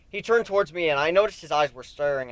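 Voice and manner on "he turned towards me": plain reading voice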